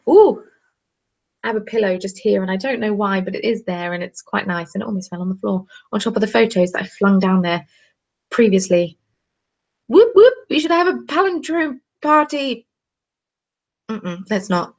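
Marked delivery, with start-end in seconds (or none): 0.1-0.4 sudden high loud ooh; startled ooh. Real, not acted. Brain fails, startled. 9.9-12.7 high, louder party cheer; excited whoop. Real, not acted. Excited suggestion.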